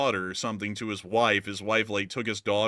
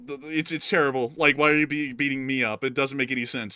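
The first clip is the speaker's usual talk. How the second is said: ordinary talk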